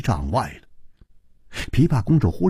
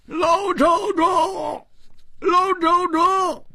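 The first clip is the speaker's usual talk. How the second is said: distant calling. Acted. Hu Tiehua hails his friend from far away, calling out. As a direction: call out as if from far off. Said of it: "他远远的就喊".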